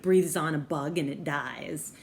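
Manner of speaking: ordinary talk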